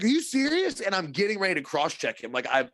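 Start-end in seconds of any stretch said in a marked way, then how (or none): 0.0-0.8 high, disbelieving question; incredulous. Retold or imitated. Player to the goalie after a cup check, high incredulous question. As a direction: ask it like you can't believe he just did that. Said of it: "I look at him, I'm like".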